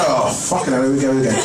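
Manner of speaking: ordinary talk, nothing marked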